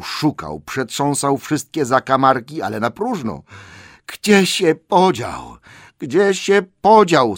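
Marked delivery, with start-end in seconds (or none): 4.1-7.4 high, loud, drawn-out lament; tearful wail. A narrator's reading. Gollum searches for his lost ring, wailing tearfully. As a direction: wail it slowly in despair. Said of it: "jego płaczliwe słowa".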